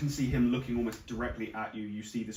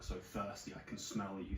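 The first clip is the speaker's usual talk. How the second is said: hushed monster's mumble; muttering to itself; 8 dB quieter than usual. Acted. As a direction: mutter it under your breath, to nobody. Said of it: "sort of muttering to itself".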